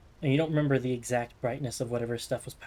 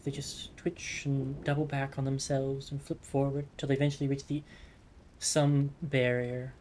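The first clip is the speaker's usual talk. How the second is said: game master narration; 2 semitones lower, 5 dB quieter than usual. Acted. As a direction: paint the sky gently, unhurried. GM describes night sky scene.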